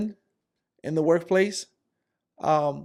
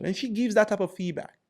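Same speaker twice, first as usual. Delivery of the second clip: usual speaking voice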